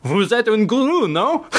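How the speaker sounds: high, hammed-up French accent; bad French accent. Paris journalist calling the author a guru, mock French accent. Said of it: "my French accent is terrible".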